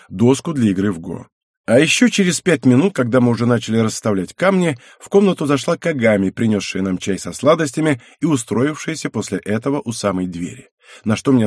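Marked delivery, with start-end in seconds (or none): none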